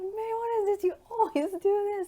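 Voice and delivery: high, loud mock yell at the student; hammed-up furious driving instructor yelling. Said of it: "And he started yelling".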